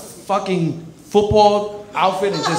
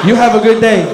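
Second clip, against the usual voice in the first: mock polite; 3 semitones higher, 8 dB louder than usual. After farting in her face.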